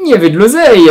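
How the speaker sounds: high, loud excited cry; excited shout. Real, not acted. Reviewer exclaiming to viewers about Beirut's wealth, excited shout. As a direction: shout it out, thrilled about the cash.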